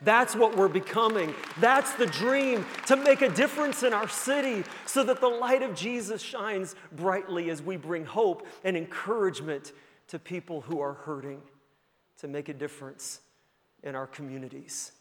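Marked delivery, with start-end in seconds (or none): none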